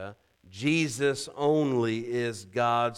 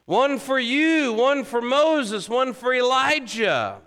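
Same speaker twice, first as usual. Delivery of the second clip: caught-up Peter; 12 semitones higher, 8 dB louder than usual. Retold or imitated. Preacher voicing Peter offering tabernacles, loud and carried away. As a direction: imitate a carried-away disciple, high and loud. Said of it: "He was caught up in the moment".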